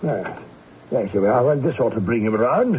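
usual speaking voice

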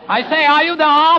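high, mock English outburst; English father. Pupil copying his coach's father role, high English voice. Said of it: "play the part of Lady Parkington's father".

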